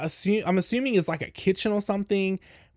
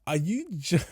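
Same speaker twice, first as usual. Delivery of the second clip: retold question dissolving into a laugh; breaking into laughter; 5 semitones higher, 3 dB quieter than usual. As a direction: start incredulously, then crack up laughing. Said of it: "I'm laughing at this point".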